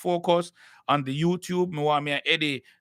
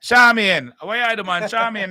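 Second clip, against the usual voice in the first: loud, raised-pitch call by name; 7 semitones higher, 7 dB louder than usual. Real, not acted. Host summoning a viewer to pay for promotion, calling out loudly.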